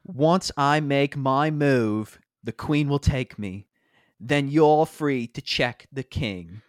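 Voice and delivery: loud, slow, solemn British delivery; British-accented Ron Weasley. Acted. Ron to Harry and Hermione in a chess scene, solemn British accent. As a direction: declaim it slowly in a British accent. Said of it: "I will be playing Ron Weasley".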